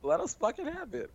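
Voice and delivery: excited cheer. Retold or imitated. Hyped cheer for Charlie.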